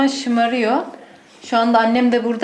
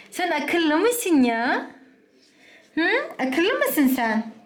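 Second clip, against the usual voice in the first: much slower, higher teasing coo; 6 semitones higher, much slower than usual. Real, not acted. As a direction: owner teasing her clever dog. Teasing dog slowly.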